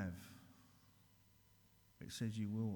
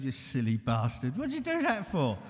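mocking taunt; 2 semitones higher, 11 dB louder than usual. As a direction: taunt him for falling. Illness mocking after relapse.

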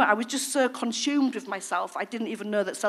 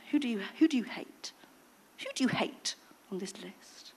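soft, whispered probing; whispered question; 7 dB quieter than usual. Retold or imitated. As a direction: lean in and whisper it. Sponsor's probing question retold.

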